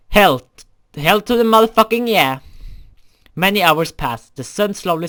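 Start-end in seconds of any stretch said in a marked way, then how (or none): 0.1-2.4 loud, high, fired-up yes; eager yell. Acted. Answering her dare with a loud excited yell. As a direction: shout it out with lusty glee.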